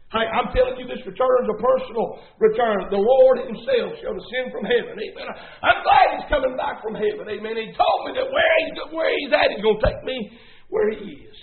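impassioned sermon delivery; driving pulpit proclamation; preaching. Real, not acted. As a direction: preach it with punchy emphasis to the congregation. Preaches to a congregation.

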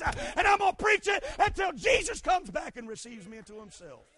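loud, rising preacher's cry; fiery preaching. Real, not acted. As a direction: preach it with full force to the church. Preaching at full force.

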